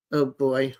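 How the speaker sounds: normal talking voice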